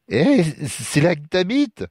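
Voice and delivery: high, slow tipsy question; drunken stammer. Acted. Drunk stranger sees where Lucas lives, slurred curiosity. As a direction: stumble through it, tipsy and curious. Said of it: "balbutia l'autre".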